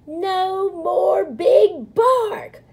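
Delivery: high, loud, slow crowd cheer. A narrator's reading. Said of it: "the crowd cheered".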